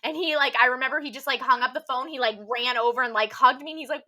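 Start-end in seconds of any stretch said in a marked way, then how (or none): none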